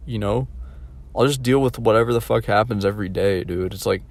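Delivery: ordinary delivery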